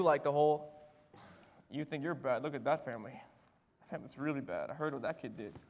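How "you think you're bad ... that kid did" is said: low, soft, confiding murmur; hushed gossip. Retold or imitated. Parents comparing their kid with another family, hushed gossip. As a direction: imitate parents gossiping under their breath.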